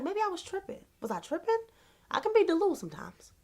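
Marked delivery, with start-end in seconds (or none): 0.0-1.6 soft, high-pitched second-guessing; self-doubting. Retold or imitated. Checking herself over the video, self-doubting. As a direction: wonder it aloud to yourself, high and small.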